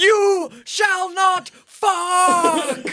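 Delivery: hammed-up wizard shriek mid-laugh; shrieking mock Gandalf. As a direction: howl it like a wizard losing it. Second Gandalf spoof, shrieked.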